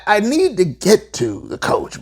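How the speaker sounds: normal talking voice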